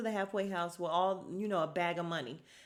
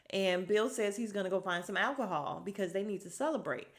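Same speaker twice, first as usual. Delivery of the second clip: ordinary talk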